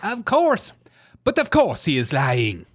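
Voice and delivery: loud, mocking put-on Southern accent; overdone imitation of a fake Southern accent; A O C's Southern accent. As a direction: punch it out in a parodied Southern twang. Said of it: "What is this accent?".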